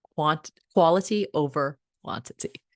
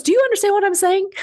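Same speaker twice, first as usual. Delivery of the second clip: high, loud and fast; said really fast; 8 dB louder, faster than usual. Retold or imitated. Grandma firing a question at Cindy, very fast and loud. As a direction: fire it off in one breath. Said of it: "She said it that fast".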